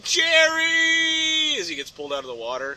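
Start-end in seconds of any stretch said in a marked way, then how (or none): 0.0-1.6 much higher shouted name; mock shout. Fish crying after its friend, high shout. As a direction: call out to a friend being pulled away. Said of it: "you're like".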